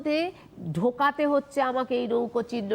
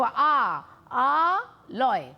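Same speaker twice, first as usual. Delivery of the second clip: long আ, a break, then লয়; syllables separated; much slower than usual. Shown as a demonstration. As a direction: say আ and লয় slowly as two pieces. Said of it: "বোঝার সুবিধার জন্য বলবো".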